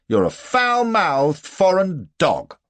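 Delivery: loud, high, slow and drawn-out; angry insult. A narrator's reading. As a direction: hurl the insult deliberately. Hero insults the German.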